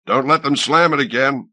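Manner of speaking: a roar of command. A narrator's reading. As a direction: bellow the order. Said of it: "roared Conda".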